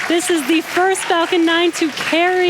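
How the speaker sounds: high, thrilled announcement; excited. Real, not acted. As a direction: let real joy lift every word. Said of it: "so very exciting for us".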